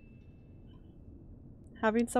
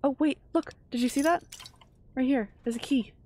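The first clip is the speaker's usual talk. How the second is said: sudden lift, louder; excited discovery; 4 semitones higher, 3 dB louder than usual. Real, not acted. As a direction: point it out eagerly. Spots a key in game.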